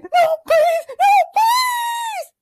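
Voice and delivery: high, loud mock pleading shriek; hammed-up begging for his life. Nico begging Walker not to kill him, hammed-up shriek. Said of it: "And Nico's like".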